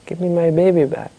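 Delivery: high-pitched distressed mother, not really shouting; storyteller's mild acted cry of a mother; mock scream. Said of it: "the woman starts screaming".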